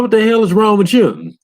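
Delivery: very high incredulous cry; a player shocked at the shooting; shocked outcry. Retold or imitated. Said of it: "You're like".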